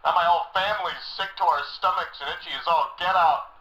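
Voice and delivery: ordinary talk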